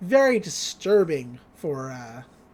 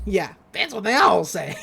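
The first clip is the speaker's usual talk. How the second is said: laughing repeat. Retold or imitated. Teller echoes McGucket's line, amused and laughing. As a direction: own pitch, laughing through it.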